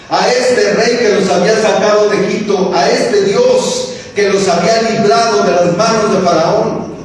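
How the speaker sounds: high, driving pulpit exhortation, each "no" hammered; rally the church to be different; preaching at full force. Acted. Preacher charging the congregation to be different, full force.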